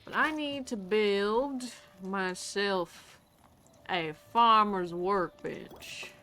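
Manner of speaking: slow, playful Texas drawl; put-on Texas accent. Player narrating farm plans to viewers, Texas accent. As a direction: draw the words out in a mock Texas accent.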